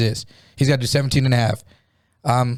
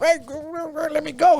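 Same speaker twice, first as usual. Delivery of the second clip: mock a pinned man as a squirming child; struggling little kid. Pinned man to his attacker, childish pleading. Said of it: "like a little kid fucking trying to get up".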